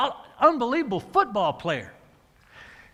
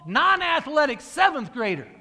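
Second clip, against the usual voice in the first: normal talking voice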